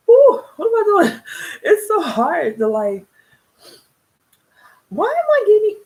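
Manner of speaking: fighting back tears, half laughing at yourself; tearful disbelief at getting emotional; choked up. Real, not acted. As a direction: voice cracking high with real emotion. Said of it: "I got emotional thinking about my mother".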